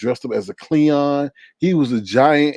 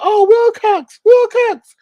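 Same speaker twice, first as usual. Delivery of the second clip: much higher, louder wailing of the cat's name; hammed-up screaming wail; 8 dB louder, slower than usual. The aunt screaming as she finds her dead cat, in a hammed-up high wail. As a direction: shriek the name over the dead pet. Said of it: "she screams".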